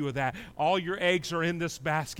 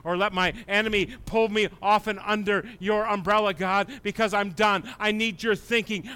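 ordinary talk

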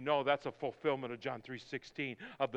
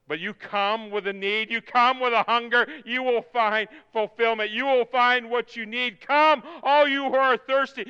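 loud, high invitation building to single shouted words; full-force preaching; 11 semitones higher, 17 dB louder than usual. Real, not acted. Preacher calling the thirsty to the waters, full-force preaching. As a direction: call them in, climbing to a shout.

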